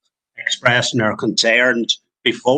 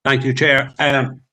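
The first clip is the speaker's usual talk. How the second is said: talking through a mouthful of food; words round a bite of food; mouth full; 3 semitones higher than usual. Real, not acted. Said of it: "you caught me with me mouth full there".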